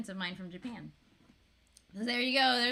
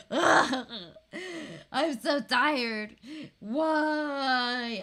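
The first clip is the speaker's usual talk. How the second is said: loud, drawn-out tired groan; worn-out parent wailing in mock despair; exasperated whine; 8 dB louder, much slower than usual. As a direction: groan it out, dragging the words.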